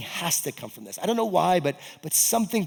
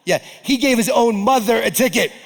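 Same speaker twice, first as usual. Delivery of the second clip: higher, louder, amused confirmation; knowing exclamation; 8 semitones higher, 8 dB louder than usual. Retold or imitated. Gym woman confirming the strict officer, higher and louder. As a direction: tell it like everyone already knows. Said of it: "They said".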